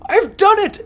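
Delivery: high, loud joyful shout; delighted little dog; triumphant cry. Acted. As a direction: burst out with pride.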